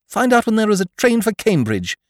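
rally the valet to action; urgent young master. A narrator's reading. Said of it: "Bertie giving orders".

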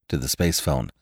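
usual speaking voice

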